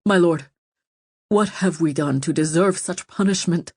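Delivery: mildly pleading appeal; imploring. A narrator's reading. Prisoner appeals to his lord about the punishment, imploringly. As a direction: appeal to him with a touch of desperation. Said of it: "He turned back to Owen imploringly".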